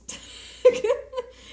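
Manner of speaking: sudden high breathy laugh; burst of laughter. Real, not acted. Laughing at her own swearing, bursting out. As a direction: laugh it out at yourself.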